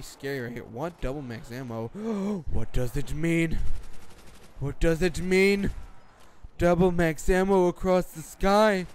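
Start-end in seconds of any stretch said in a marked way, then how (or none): 1.9-2.5 sharp high intake of breath; exaggerated shocked gasp; mock gasp. 2.5-8.9 loud, slow, drawn-out amazement; hammed-up overwhelmed wonder; mock awe. Parody of amazed viral video.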